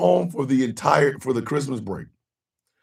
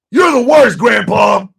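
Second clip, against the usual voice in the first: exasperated shout; 5 semitones higher, 6 dB louder than usual. Real, not acted. Yelling at Mike Johnson.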